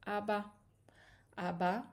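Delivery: Abba said clearly, rising at the end; reading out Abba. Shown as a demonstration. Teacher revealing the final word to students. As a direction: present Abba proudly and clearly. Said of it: "Et voilà".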